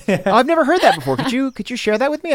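high, mock-eager request; playful sarcasm. Real, not acted. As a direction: fake gush over it. Teasing request.

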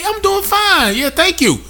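mock courteous reply, pitched high; polite reply. Showing a customer the least she could say, high polite reply.

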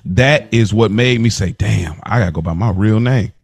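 ordinary talk

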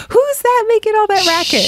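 high-pitched mock outrage; hammed-up, high indignant voice; indignant mourners. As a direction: play a scandalized funeral guest complaining. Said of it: "everybody will be turning their heads and saying".

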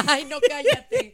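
helpless laughter into slow words; laughing. Real, not acted. Host reacting to his absurd confession, laughing disbelief. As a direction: laugh hard, then drag the words out.

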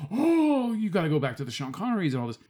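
high exclaimed Oh, then fast; mock insistent fan. Mocking people who praise old Bond films, fast insistent. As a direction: mimic the know-it-all fans.

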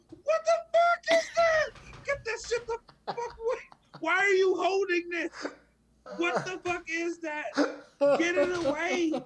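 high-pitched, loud panicked shriek; horrified cussing out. Retold or imitated. Retold reaction to girl's horseshoe crab, horrified screeching. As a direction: freak out, voice shooting way up, ordering it away. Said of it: "I'm cussing this little kid out".

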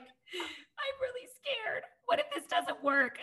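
teary laugh while quoting her own fear to her partner; tearful laughing quote. Retold or imitated. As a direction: retell your scared words through a teary laugh. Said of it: "And I'm just like".

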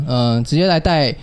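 ordinary talk, nothing marked

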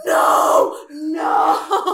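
real shouted dread; horrified shout. Real, not acted. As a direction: shout it as the scene scares you. Reacting to horror story.

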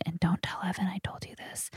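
hushed, secretive aside; whispering. Real, not acted. Confiding a secret about Evan to listeners, whispering. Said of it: "I love how I'm whispering right now".

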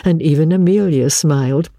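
ordinary delivery, nothing marked

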